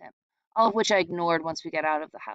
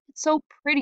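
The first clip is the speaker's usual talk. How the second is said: tearful wonder; 8 semitones higher, faster than usual. A narrator's reading. Doodle moved to tears.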